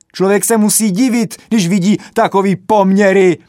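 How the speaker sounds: high, loud indignant tirade; furious rant. A narrator's reading. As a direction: tear into them, outraged. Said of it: "zasyčel".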